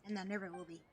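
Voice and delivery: ordinary talk, nothing marked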